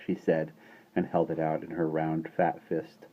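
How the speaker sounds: ordinary delivery, nothing marked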